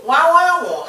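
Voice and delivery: Nwanwanwu called out high and loud as "wah-wah-wo"; mispronounced name. Retold or imitated. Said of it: "I have to correct them".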